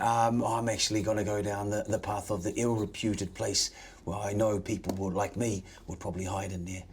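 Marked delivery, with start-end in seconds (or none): none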